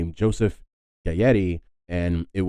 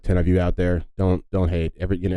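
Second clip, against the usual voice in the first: normal talking voice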